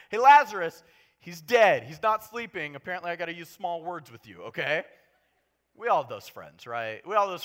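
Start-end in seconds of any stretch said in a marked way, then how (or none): 0.1-4.8 exasperated. Pretend. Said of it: "tell these disciples as plainly as possible".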